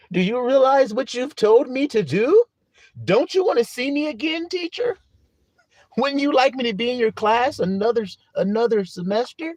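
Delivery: high, imploring schoolkid voice; pleading pupil. Acted.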